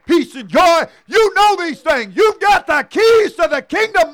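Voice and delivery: high, loud building sermon climax; full-force preaching. Real, not acted. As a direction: preach it with rising fire.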